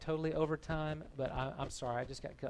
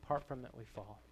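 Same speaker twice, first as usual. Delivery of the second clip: subdued repeat; 5 dB quieter, faster than usual. Real, not acted. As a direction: let it sink in quietly after the big proclamation. Hushed repeat after long pause.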